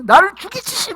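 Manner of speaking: high, loud anguished protest; hammed-up outraged wail; acted shouting at God. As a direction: cry out in mock despair. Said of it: "막 소리치고".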